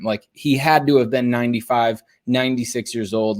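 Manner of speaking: ordinary talk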